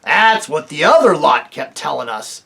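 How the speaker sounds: loud scornful dwarf. Acted. A dwarf scoffs at Tirian, loud and scornful. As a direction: dismiss it.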